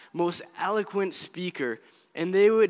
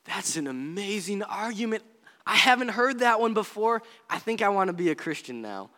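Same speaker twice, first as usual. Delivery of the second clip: put-on voice of instant amazement; mock convinced skeptic; 4 semitones higher than usual. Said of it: "I don't think he'd be like".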